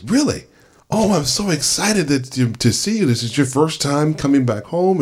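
high, delighted welcome; excited driver. Retold or imitated. Irish driver thrilled to meet a returning visitor, high and eager. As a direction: gush like a thrilled host.

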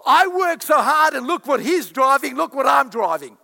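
high, loud envious grumble about a richer man; hammed-up envious complainer; loud mock envy. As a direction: whine it with jealousy.

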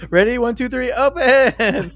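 high, loud excited count; excited countdown. Real, not acted. Counting down to open doors.

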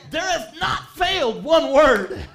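loud, slow, ringing proclamation; shouted scripture, drawn out; declaring shout. Real, not acted. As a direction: proclaim it to the rafters. Preacher declaring scripture aloud.

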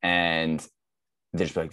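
usual speaking voice

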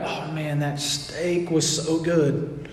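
hushed, slow longing for steak; dreamy sigh. Retold or imitated. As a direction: sigh it out, lost in memory. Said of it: "they're like".

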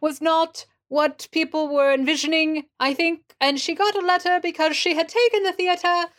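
high, theatrical British voice; fake British accent. [name] gossips about the production's letter, in fake British accent. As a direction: hold forth in a put-on British accent. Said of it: "I found the voice".

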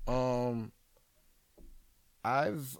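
ordinary delivery

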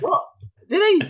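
high, loud shocked question; surprised. Retold or imitated. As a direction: gasp it out in disbelief. Shocked at brandy story.

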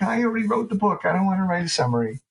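high-pitched complaining whine; whiny writer. Acted. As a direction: whine like a put-upon writer dodging a chore. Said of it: "we all have a whiny writer".

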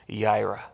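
"yira" said clearly, YEE-rah, stress on first syllable; teacher modelling the word "yira" for the class to repeat; Hebrew yira. Shown as a demonstration. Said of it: "if you could repeat this".

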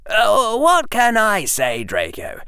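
high, innocent schoolboy; Harry voice. Acted. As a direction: play the innocent.

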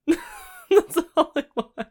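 high and slow through a laugh. Real, not acted. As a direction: laugh the words out. Laughs at own joke.

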